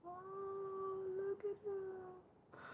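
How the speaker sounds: very high, tiny soft coo; tender cooing. Real, not acted. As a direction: melt over a newborn, slow and hushed. Cooing at friend's new baby.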